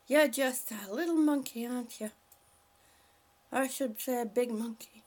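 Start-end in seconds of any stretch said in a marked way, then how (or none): none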